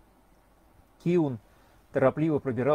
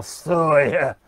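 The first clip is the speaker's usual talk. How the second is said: put-on drawn-out drunken roar, louder; hoarse bellow; 7 dB louder, much slower than usual. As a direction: bellow slowly at the passing rider like a hungover trooper. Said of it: "сипло взревел".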